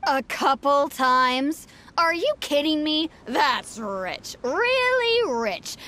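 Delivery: slow, drawn-out incredulous sarcasm; scornful disbelief. Acted. Farmer scoffing at the neighbour's excuse, indignantly sarcastic. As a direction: stretch the words out in mocking disbelief.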